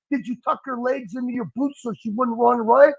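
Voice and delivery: normal talking voice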